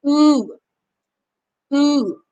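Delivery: "Ooh" with lips rounded, a long pure oo; long oo sound. Shown as a demonstration. Teacher demonstrates the second long U sound, drawn out. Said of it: "can also sound like this".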